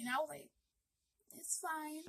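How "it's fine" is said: very soft, small answer; retold reluctant reply. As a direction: barely get it out, as a shaken child would. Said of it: "get out words".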